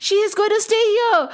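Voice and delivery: high-pitched, insistent refusal; worked-up storybook protest; urgent protest. A narrator's reading. Sage protesting the cow's seizure.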